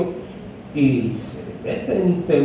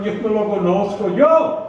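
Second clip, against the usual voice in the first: 1 frantic denial; 3 semitones higher than usual. Acted. Peter denies again, voiced.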